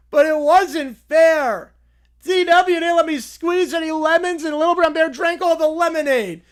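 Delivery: put-on high, loud complaining child; whiny kid. Kid complaining to the boss, high and loud whine. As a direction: whine that it wasn't fair.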